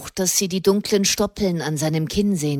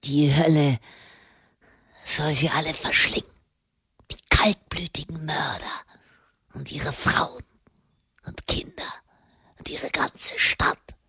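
low, hissed curse fading to breath; damn them all in a furious undertone; whispered fury; 5 semitones lower, 5 dB quieter than usual. A narrator's reading. Prisoner damning his murderers and their city, whispered fury. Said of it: "bebten von seiner Wut".

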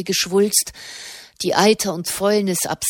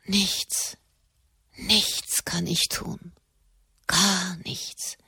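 slow, hushed helplessness; whispered; 7 dB quieter, much slower than usual. A narrator's reading. Katharina realises she can do nothing, whispering. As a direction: whisper it haltingly, much softer. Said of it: "flüsterte sie".

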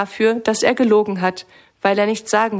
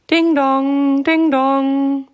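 doorbell chime; 5 semitones higher, much slower than usual. Acted. Doorbell rings at Brandmüllers' door.

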